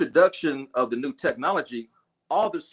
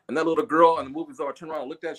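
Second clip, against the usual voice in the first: ordinary talk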